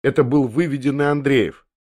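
ordinary delivery

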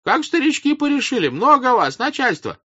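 very high, loud peasant protest; sudden high-pitched clamour; clamouring crowd voices. A narrator's reading. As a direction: pipe up loudly from the back of a crowd. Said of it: "несколько голосов сзади в то же мгновение заговорили вдруг".

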